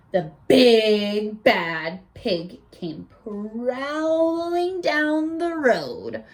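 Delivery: plain reading voice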